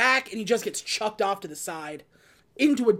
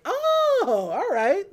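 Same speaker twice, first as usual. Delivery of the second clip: high, loud startled oh; mock startled; 11 semitones higher, 4 dB louder, much slower than usual. Jay caught by the big bug, startled squeak. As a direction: jump up high on the oh.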